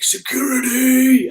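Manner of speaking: a drawn-out, high mock shout for security; imitating Tony's shout. Tony Robbins calls security from outside, imitated shout. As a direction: call for security like the boss, slow and high. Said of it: "he shouted".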